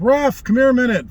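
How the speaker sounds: high, loud call across the field; calling the ref. Retold or imitated. Kneeling player calling the referee over, loud. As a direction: shout to get the ref over.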